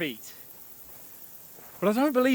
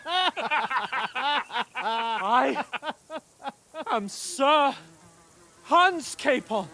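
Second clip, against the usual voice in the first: pompous proclamation; 3 semitones higher, much slower than usual. Acted. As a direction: proclaim the title with mock grandeur. Grand self-announcement at gate.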